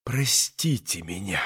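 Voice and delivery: soft, low, broken apology; near-whispered moan. A narrator's reading. Katya begging Grushenka's pardon, near-whispered moan. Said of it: "тихо, почти шёпотом простонала ей".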